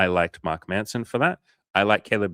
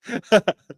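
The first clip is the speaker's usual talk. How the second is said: high, loud real laugh; a high, loud burst of real laughter; 12 semitones higher, 7 dB louder than usual. Real, not acted. Laughs at own remark.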